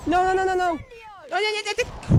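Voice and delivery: high, loud panicked no's; panicked shout. Real, not acted. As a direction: yell in real panic as it fails. Failing the mandrake rescue.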